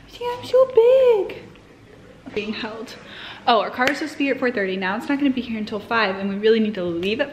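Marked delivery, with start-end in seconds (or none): 0.1-1.4 much higher, slow cutesy pet voice; voicing the dog. Owner speaking for her dog to viewers, high cutesy voice. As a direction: speak for the puppy in a tiny high voice.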